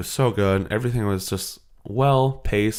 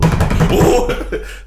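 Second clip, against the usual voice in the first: laugh; 7 dB louder than usual. Real, not acted. Laughing before naming Spotify.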